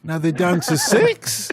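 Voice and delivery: slow mock question leaping up on "six"; mimicked uptalk. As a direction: parody the caller by shooting "six" upward. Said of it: "going up at the end of your sentence".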